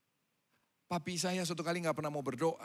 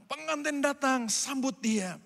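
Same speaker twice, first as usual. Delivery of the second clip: slow, louder announcing call; calling out arrival; 7 semitones higher, 6 dB louder, slower than usual. Acted. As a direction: proclaim it like a herald. (seruan lantang)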